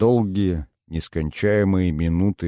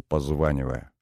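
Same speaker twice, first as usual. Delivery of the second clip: plain reading voice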